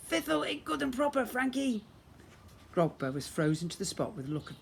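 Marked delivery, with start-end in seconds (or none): 0.1-1.8 put-on lisping old sister. Sister cheers with lisp.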